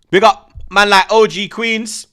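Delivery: slow, loud, high hype call; loud shoutout. Real, not acted. Streamer shouting out a viewer, loud and drawn out. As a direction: give a viewer a big shoutout.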